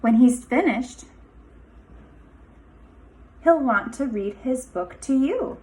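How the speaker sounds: lively storybook read-aloud with a sing-song lilt; picture-book voice with rising, inviting phrases; reading to children. Acted. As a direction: read it like story time for a class of little kids. Teacher reads picture book aloud.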